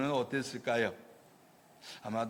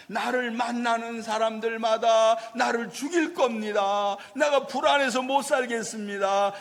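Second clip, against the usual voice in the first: higher, louder frightened pleading; acted fearful plea; 6 semitones higher, 4 dB louder than usual. Cain confessing his fear to God, loud anxious pleading. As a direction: beg God, scared for his life. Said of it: "그렇게 고백을 하고 있어요".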